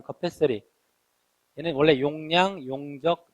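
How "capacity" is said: American capacity with a soft flapped t; American pronunciation. Shown as a demonstration. Teacher shows students the American pronunciation of capacity, t flapped. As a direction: say capacity the American way, ending like "-sery". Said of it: "미국식 발음".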